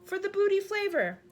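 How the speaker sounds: high loud giant cry; cried out. A narrator's reading. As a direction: shout it out, delighted. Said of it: "cried the B F G".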